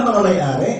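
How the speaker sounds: ordinary talk, nothing marked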